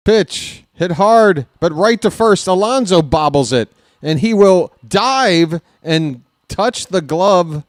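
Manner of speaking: high, loud, urgent call; punchy, alarmed baseball call; excited play-by-play. Real, not acted. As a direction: follow the bobbled ball breathlessly to the bag. Tense live call of final out.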